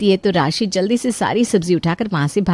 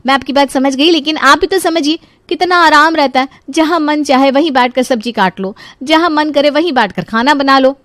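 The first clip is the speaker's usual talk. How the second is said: higher-pitched persuasive voice; eager, raised-pitch justification; cajoling daughter-in-law; 6 semitones higher than usual. Acted. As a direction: argue her case cheerfully.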